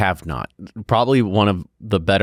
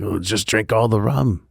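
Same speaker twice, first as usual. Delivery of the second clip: lightly voiced Captain Jack pirate accent, slightly higher; a quick joking swagger of a Pirates of the Caribbean pirate accent; 4 semitones higher than usual. Host jokes about rum to cohost, mild pirate accent. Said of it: "Pirates of the Caribbean accent going".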